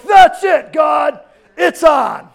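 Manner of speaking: loud, high, hammed-up angry shout; mad outburst. Preacher acts out someone mad at God, shouting angrily. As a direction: blow up in mock fury. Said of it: "got so mad before at God".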